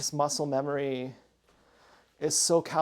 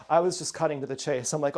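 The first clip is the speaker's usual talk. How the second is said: usual speaking voice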